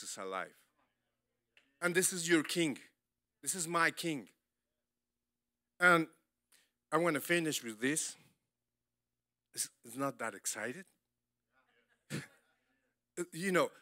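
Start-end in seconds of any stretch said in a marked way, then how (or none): none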